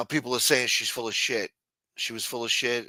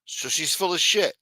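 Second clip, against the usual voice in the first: ordinary delivery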